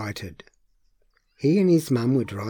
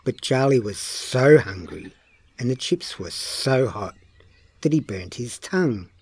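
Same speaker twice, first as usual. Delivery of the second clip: ordinary delivery, nothing marked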